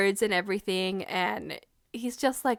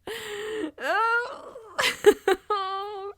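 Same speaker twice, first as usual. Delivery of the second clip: high wailing laugh, near tears; tearful squeal; 11 semitones higher than usual. Real, not acted. Fan overcome by the kiss buildup, tearful squealing. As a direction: squeal as if about to cry. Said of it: "That's what made me cry".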